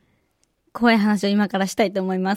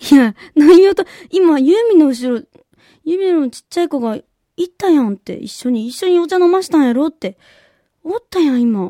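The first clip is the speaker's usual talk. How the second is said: a hint of an insistent mother; 6 semitones higher, 6 dB louder than usual. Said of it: "って言って".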